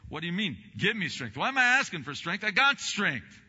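high, loud and quick mock disbelief; incredulous retort. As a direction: shout down your own doubt.

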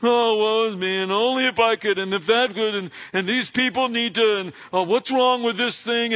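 mock whining. Preacher mocking a complaining believer, mock whiny voice. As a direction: lightly whine it like a self-pitying grumbler. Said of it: "complaining and whining".

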